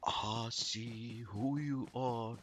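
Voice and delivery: very slow, hushed, drawn-out delivery; creepy slow-motion murmur; talking slow. Shown as a demonstration. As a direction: stretch every word out softly and low. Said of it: "Or I talk slow".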